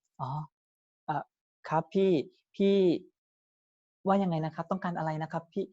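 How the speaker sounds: hesitant, with long gaps between words; slow-talking salesman. Acted. Salesman slowly asking a quick customer what they need. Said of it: "แล้วเราพูดช้า".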